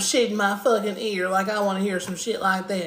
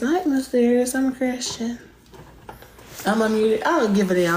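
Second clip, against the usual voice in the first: normal talking voice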